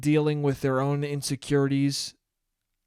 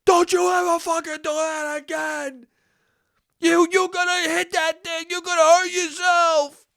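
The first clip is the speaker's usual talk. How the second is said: much higher pitched furious yell; yelling like bloody murder; 12 semitones higher than usual. Retold or imitated. Dad yelling at his son after the near crash, furious shout. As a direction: scream the warning at full fright and anger. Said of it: "yelled at me like bloody murder".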